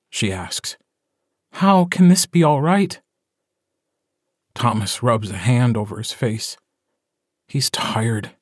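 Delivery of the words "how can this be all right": high-pitched, incredulous question; upset mother questioning her mate; distressed appeal. A narrator's reading. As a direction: lift the voice high in protest. Pleading mother in story quarrel.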